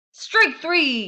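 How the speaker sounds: high, loud umpire call; umpire yell. A narrator's reading. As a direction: bellow it like an umpire. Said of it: "yelled the umpire".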